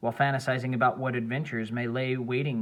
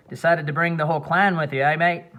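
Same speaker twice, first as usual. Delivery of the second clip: a lightly voiced Australian accent, a touch louder; 4 semitones higher, 6 dB louder than usual. A narrator's reading. Mickey offering his flask to Xander, in a light Australian accent. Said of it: "in his thick Australian accent".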